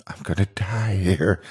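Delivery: frightened whisper; whispered thought. A narrator's reading. Narrator on his knees in Pirate's Cove, whispering. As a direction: breathe the thought out in terror. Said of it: "I think".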